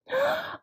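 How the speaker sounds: sharp high gasp of fright; startled gasp. A narrator's reading. Goldilocks waking to three bears, a high frightened gasp. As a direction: gasp as she sees the bears.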